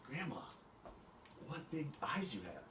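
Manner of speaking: put-on childlike amazement. Girl marvels at disguised wolf.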